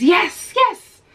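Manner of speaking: high, loud, ecstatic yes, yes; excited cry. Retold or imitated. Stranger exclaims yes as she leaves, high and excited. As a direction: shriek it out in joy.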